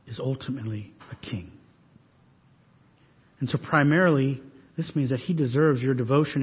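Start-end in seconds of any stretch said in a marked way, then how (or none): 0.1-1.5 much softer, low and weighty; hushed emphasis. Acted. Preacher telling the congregation who Jesus truly is, hushed. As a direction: let it sink in quietly. Preacher drops voice on key claim.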